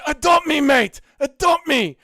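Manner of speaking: loud, high begging shout of adopt me; shouted impression. Streamer shouts a fan's plea to chat, high and loud. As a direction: yell it like a desperate fan begging. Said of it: "impression of Ch".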